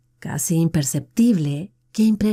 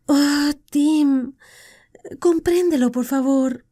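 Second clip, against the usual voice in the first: higher woman's voice begging him to understand; pleading; 8 semitones higher, slower than usual. Acted. Deirdre begs Tim to understand her marriage, pleading. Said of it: "suplicó Deirdre".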